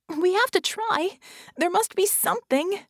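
high, urgent pleading; desperate plea. Acted. Vicky begs Red for any solution, desperate. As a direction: plead in rising panic.

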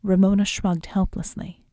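ordinary delivery

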